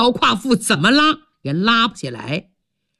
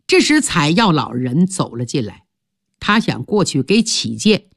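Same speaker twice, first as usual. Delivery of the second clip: usual speaking voice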